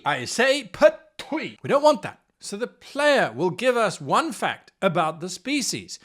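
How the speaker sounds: ordinary delivery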